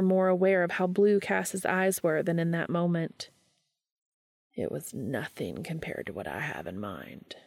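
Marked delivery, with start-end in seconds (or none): 4.5-7.4 much lower, softer, gravelly Castiel; Castiel's low, seductive rasp; deep rough voice. A narrator's reading. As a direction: let it sink deep and rough like sandpaper. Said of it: "Castiel's voice was deep and rough like sandpaper".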